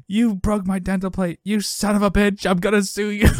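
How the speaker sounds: high-pitched indignant retold threat; hammed-up furious accusation, pitched well up; mock outrage. Man with broken dental plate threatens to sue, mock outrage.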